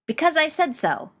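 high, loud, impatient bark; loud, bossy retort; barked order. A narrator's reading. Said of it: "Ed barked".

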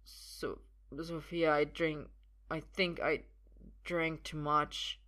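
put-on slow, broken drunken slur; fading, woozy confession with gaps; struggling to speak. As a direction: force each phrase out haltingly. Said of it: "becoming harder to get the words out".

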